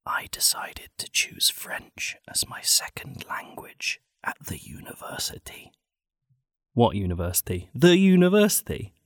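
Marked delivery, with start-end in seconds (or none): 0.0-5.7 slow, soft unvoiced-style whisper; ASMR whisper. Comedian reading a sad story like it's ASMR, whispering. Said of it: "like it's A S M R". 7.8-8.9 loud, high mock query; mock incredulous emphasis. Comedian querying the story's wording, loud mock disbelief.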